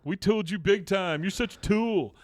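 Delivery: lightly acted gloating tease; mocking taunt. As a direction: rub it in like a teasing kid. Teenager taunting in a retelling.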